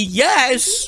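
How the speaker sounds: high, loud yes; excited cheer. Real, not acted. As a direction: shout it in triumph. Celebrating to chat.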